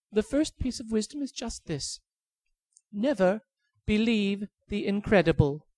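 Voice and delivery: high pleading bird voice; tiny high bird begging for its life. Acted. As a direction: squeak like a begging little bird.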